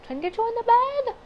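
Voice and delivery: high and loud, giddy disbelief; excited squeal. Real, not acted. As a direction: squeal it out with delight. Excited reaction to flirting character.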